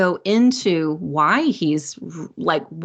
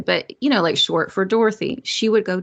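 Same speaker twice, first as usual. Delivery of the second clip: ordinary talk, nothing marked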